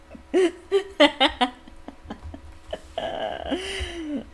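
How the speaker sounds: bright high laugh at the joke; real laugh. Real, not acted. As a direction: laugh out at the prank.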